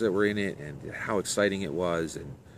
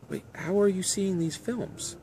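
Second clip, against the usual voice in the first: high, slow, bewildered question; pitched-up bafflement, taking it slowly; incredulous; 13 semitones higher than usual. Acted. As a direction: draw it out in acted disbelief. Arnold baffled by Sarah's visions.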